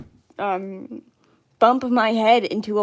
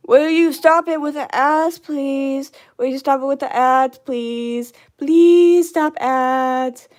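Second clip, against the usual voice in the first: loud, higher, drawn-out whiny begging; mock pleading; 4 semitones higher, 7 dB louder, slower than usual. Streamer begging the ads to stop, mock pleading. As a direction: plead with drawn-out words, playfully exasperated.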